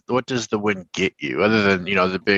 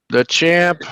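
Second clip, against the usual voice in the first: excited greeting; 6 semitones higher, 6 dB louder than usual. Real, not acted. Hails winner arriving.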